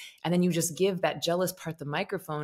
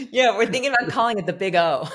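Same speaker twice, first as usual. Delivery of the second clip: laughing through the joke, louder; 5 semitones higher, 8 dB louder than usual. Real, not acted. Host jokes to guest about naming it, laughing. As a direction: joke about it while cracking up. Joking about an orgasm meditation.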